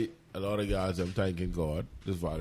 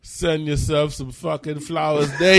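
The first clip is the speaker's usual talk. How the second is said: mock proclamation; 7 semitones higher, 9 dB louder than usual. Radio host declaring a made-up holiday, loud mock proclamation. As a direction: proclaim the joke holiday with full force.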